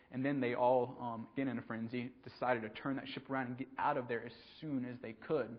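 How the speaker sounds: ordinary delivery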